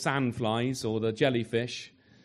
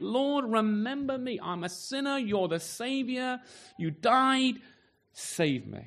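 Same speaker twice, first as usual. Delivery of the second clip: higher, slow pleading cry to the Lord; 7 semitones higher, slower than usual. Retold or imitated. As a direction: call out slowly, like a desperate appeal. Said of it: "Just cried out".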